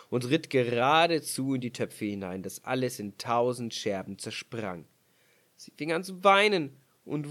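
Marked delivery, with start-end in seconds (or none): none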